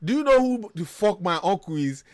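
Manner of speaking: acting out an indignant, high-pitched shout; retold shouting. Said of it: "So I started shouting".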